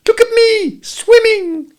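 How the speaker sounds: very high, loud squeak; squeaking Roo. A narrator's reading. Little Roo calling out from the pool, squeaking. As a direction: squeak it like a tiny excited kangaroo.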